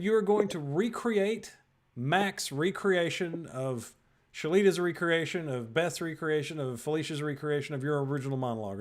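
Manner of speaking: ordinary talk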